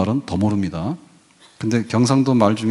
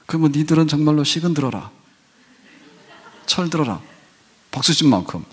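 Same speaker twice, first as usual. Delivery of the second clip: mock scolding; 4 semitones higher than usual. Admonishing the young.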